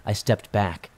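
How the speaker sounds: usual speaking voice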